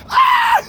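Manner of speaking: shrill falsetto shriek of alarm; high mock scream. Screaming as the boy walks toward him. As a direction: scream like a panicked kid.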